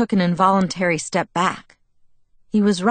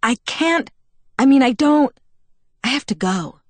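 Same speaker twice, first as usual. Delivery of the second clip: rushed and higher, stumbling; flustered girl backing away; 7 semitones higher, 5 dB louder than usual. Acted. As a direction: trip over the words trying to leave. Bella flustered, stammering to leave.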